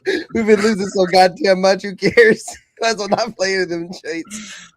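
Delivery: helpless laughter breaking into high-pitched speech; real laughing. Real, not acted. Host joking about the losing team, laughing hard. Host cracking up at own joke.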